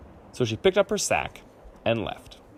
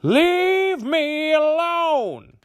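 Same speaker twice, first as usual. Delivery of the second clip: drawn-out, very high, loud shout; 4 dB louder, much slower than usual. A narrator's reading. Old woman yelling back at her family as she leaves, drawn-out shout. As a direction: stretch it out in a huge high yell. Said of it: "she shouted back".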